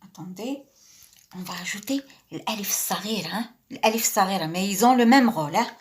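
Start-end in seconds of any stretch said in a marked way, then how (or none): none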